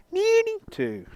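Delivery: high sing-song call of the name; calling the cat. Shown as a demonstration. Showing how he will call his new cat, high sing-song. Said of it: "call this cat now and say".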